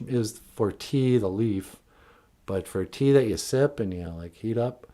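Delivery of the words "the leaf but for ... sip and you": ordinary talk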